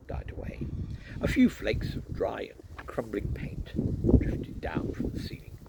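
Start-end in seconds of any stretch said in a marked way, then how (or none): none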